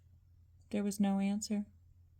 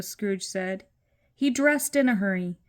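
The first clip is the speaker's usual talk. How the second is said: ordinary delivery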